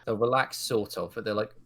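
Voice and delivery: normal talking voice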